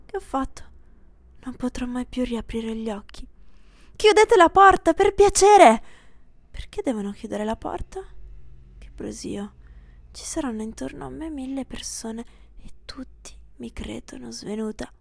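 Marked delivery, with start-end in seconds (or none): 4.0-5.8 high, loud urgent call; a shout to close the door. Acted. Someone calls out for the door shut. 8.8-14.9 soft dazed whisper; murmured inner thought. Acted.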